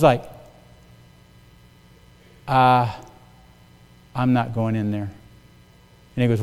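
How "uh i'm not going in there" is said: low, quiet, hesitant refusal; stall, then timidly refuse under your breath; scared, hesitant reply. Retold or imitated. New manager balking at his boss outside a bar, scared and hesitant. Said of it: "I was so scared".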